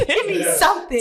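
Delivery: high, giggly begging; laughing mock plea. Co-host joining the plea for stats, laughing mock begging. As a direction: plead in fun through laughter.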